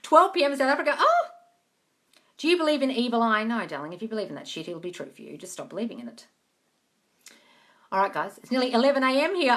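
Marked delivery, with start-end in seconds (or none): none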